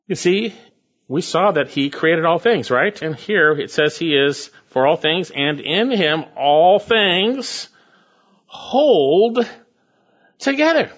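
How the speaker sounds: preacher lifting the congregation; rising preaching. Acted. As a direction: build to a soaring finish. Building to comforting climax.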